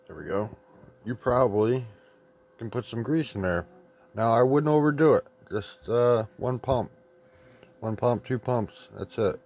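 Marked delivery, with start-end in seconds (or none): none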